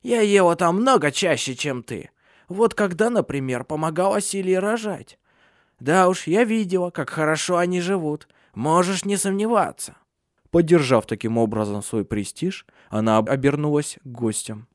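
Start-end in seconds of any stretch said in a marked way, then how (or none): 0.0-10.0 put-on high-pitched old witch voice; much higher old-woman voice, asserting her standing; boastful old crone. A narrator's reading. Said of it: "прошипела Ханна".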